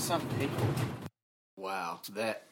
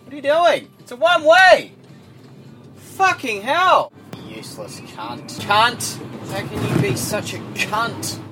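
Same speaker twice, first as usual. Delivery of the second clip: road rage; 6 semitones higher than usual. Real, not acted. Driver caught on tape swearing at other drivers, furious yelling. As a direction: rage at every car on the road. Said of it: "I see what you guys mean".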